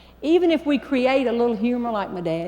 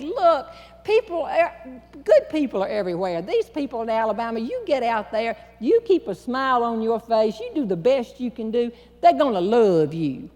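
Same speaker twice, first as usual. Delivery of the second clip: put-on Southern accent. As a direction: comfort the nervous contestant, Southern style. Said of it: "how Southern I had become on the word love".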